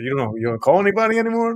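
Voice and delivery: hammed-up New York accent, quick and high; mock New York accent. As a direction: echo the guest's New Yorker line, teasing. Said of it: "I missed that accent".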